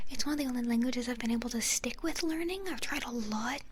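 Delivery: ordinary delivery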